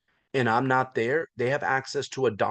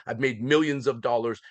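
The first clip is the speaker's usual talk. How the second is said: ordinary talk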